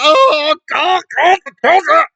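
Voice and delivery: high, loud mock-wailing; mock hysterical woman. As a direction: ham up fake panic for the children. Mocking Marilyn's fake concern.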